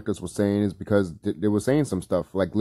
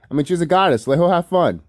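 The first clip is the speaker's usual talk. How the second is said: excited; 7 semitones higher than usual. Real, not acted. Defending Libby excitedly.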